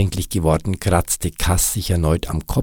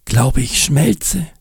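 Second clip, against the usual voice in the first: langsam, höher und weinerlich; schluchzend; 9 semitones higher, 3 dB louder, slower than usual. Acted. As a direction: klag langsam und untröstlich. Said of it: "Untröstlich schluchzte er weiter". (Nick klagt schluchzend)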